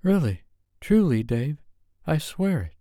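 high, earnest woman's voice; sincere wife insisting. A narrator's reading. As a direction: plead your case earnestly.

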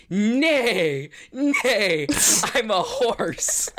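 high silly neighing horse. Acted. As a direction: play a goofy talking horse. Speaker plays a horse.

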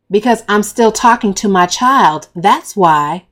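put-on loud snap at the boy; 对男孩大声呛回去; snapped. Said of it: "I snap".

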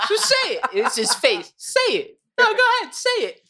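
high, teasing dare; mock goading. Interviewer daring the guest to say it, high and teasing. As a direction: egg him on in a high voice.

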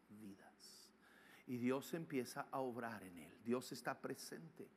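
low, soft phrases with long pauses; hushed reverent preaching. Real, not acted. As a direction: lead them softly, phrase by phrase. Soft reverent preaching, congregation repeats.

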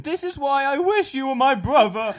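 high, loud, slow gushing cartoon voice; slow, high, loud adoring squeak; cartoon sidekick voice. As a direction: gush at your hero like a cartoon sidekick. Cartoon skit, admiring sidekick.

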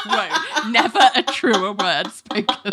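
high, slow, laughing sarcastic marvel; mock amazement. Reacting to the heroine calling herself demented, slow laughing mock awe. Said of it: "And I was like".

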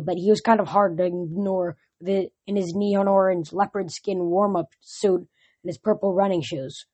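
plain reading voice

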